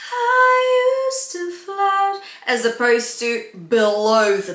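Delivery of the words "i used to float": "I used to float" kept high and light in falsetto; above the pencil. Shown as a demonstration. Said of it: "above the pencil".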